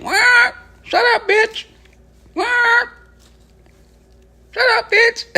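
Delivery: very high-pitched parrot squawk. Retold or imitated. Streamer mimicking a parrot from a video, shrill squawk. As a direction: screech it like a talking parrot. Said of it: "the parrot was motherfucker saying".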